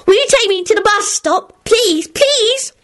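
put-on very high, pleading little boy; happily yelling child. A narrator's reading. Teddy begging Elizabeth for company, happily pleading. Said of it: "Teddy yelled happily".

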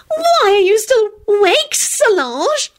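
high slow whiny voice; peevish whine. A narrator's reading. Loretta to Solange, high peevish whine. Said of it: "Loretta whined peevishly".

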